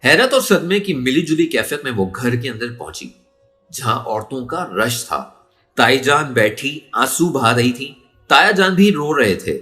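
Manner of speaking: usual speaking voice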